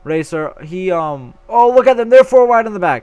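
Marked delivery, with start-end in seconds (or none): 1.5-3.0 sudden burst of excitement, louder; excited. Real, not acted. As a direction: shout it out, thrilled. Sudden excitement at four-wide racing.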